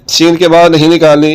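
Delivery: normal talking voice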